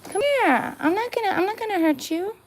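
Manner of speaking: high coaxing voice for a cat; pet talk. Acted. Talking to a stray cat at her door, high coaxing pet voice.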